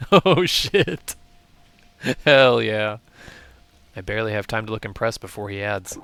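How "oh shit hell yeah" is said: loud, high hype yell; excited shout. Real, not acted. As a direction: shout it out thrilled. Learns NPC is the Shade.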